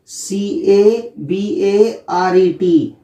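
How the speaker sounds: each letter spaced out slowly; slow spelling out. Shown as a demonstration. Teacher spelling cabaret for learners, slow. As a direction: dictate the letters one at a time. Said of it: "इसको learn करने के लिए".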